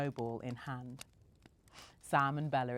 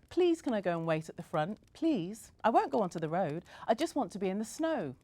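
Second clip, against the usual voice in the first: put-on high pleading little-girl voice, pushing faster; whining child; 6 semitones higher, 3 dB louder than usual. A narrator's reading. Bella begging her mum to wait outside, high whine. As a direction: beg like a small girl desperate for snow. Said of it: "Bella whined".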